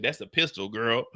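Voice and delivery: low, cool gangster answer; deep tough voice. Retold or imitated. Juke answering the girl, low and cocky.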